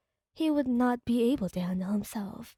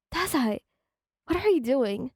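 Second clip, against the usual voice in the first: mild cry out; 2 semitones higher than usual. A narrator's reading. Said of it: "you cried out".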